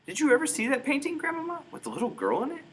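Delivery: put-on high, earnest young boy's voice; a doubting little boy asking his grandmother; small boy pleading.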